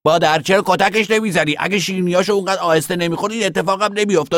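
put-on high, insistent child voice; indignant little boy. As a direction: nag mother like an outraged kid. Little boy demands sister be beaten.

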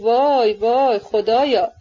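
drawn-out, slow wail of dismay; slow, lamenting exclamation; dismayed cry. A narrator's reading. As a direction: cry out to heaven in mild horror. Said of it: "دستها را به آسمان برد و گفت".

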